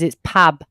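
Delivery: pab with an open a vowel; London pab. Shown as a demonstration. Showing how people from where Jan is say pab. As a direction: say pab, flat open a instead of u. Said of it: "Or if you're from where Jan is".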